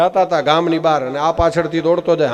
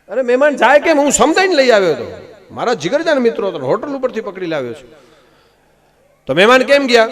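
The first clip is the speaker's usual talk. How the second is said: higher, louder outraged husband; angry shout; 8 semitones higher, 7 dB louder than usual. Acted. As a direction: shout it at the wife, indignant. Husband finds guests gone.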